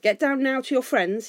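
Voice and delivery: loud, high command; angry order. A narrator's reading. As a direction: order him away sharply. Thorin orders Bilbo down.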